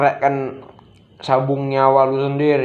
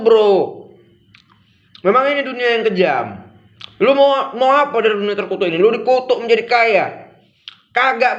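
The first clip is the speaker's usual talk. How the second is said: raised, heated sermon voice, pitched well up and pressing hard on every point; fired-up preaching; 8 semitones higher than usual. Acted. Vlogger lecturing his viewers about the cursed world, fired-up preaching. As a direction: preach it at full force like a street preacher.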